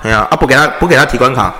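normal talking voice

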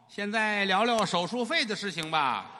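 sly doctor. Acted. Doctor voiced in crosstalk story.

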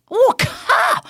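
high, loud shocked cry; shocked exclamation. Real, not acted. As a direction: blurt it out in disbelief. Shock on hearing chairman.

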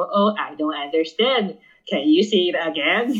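slow, cheeky mock politeness; a slow, slightly smug classroom plea; cheeky slow English. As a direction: drag out the English a little smugly. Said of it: "那很欠揍欸".

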